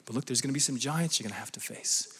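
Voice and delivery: hushed, breathy warning to the church; whisper. Acted. Preacher whispering a warning to the congregation. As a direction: lean in and whisper it.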